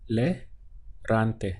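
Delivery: le- gante broken into syllables. Shown as a demonstration. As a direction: spell out le- gante slowly. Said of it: "So we have to".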